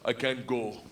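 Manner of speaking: put-on low, halting Russian accent. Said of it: "And he goes".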